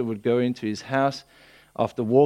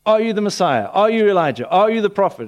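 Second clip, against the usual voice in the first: high, louder demanding questions; acted interrogation; 7 semitones higher, 5 dB louder than usual. Religious elite questioning who John is, insistent mock interrogation. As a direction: press each question like an interrogator.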